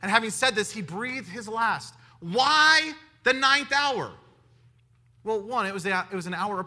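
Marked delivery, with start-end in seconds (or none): 2.2-4.1 drawn-out question. Real, not acted. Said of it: "rhetorical question to congregation".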